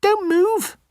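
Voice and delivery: very high squeaky little mouse; tiny mouse voice. Acted. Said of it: "says the mouse".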